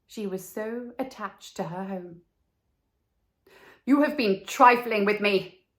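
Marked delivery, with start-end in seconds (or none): 3.9-5.7 loud hurt reproach; cried out. Acted. Swallow crying out to the reed, louder reproach. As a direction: accuse her loudly. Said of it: "he cried".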